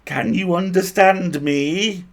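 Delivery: slow, high, loud bad Welsh lilt; an elf with a hammy Welsh accent. A narrator's reading. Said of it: "he asked in fair, if oddly accented".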